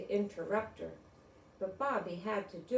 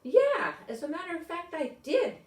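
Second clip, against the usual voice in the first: surprised; 10 semitones higher, 6 dB louder than usual. A narrator's reading. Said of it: "looked surprised".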